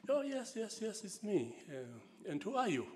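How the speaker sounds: lightly imitating Namibian English; Namibian accent. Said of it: "in Namibian English".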